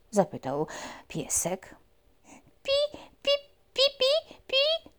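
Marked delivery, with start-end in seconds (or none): 2.6-4.9 very high mouse squeaks; worried squeak. Acted. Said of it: "zaniepokoiła się myszka".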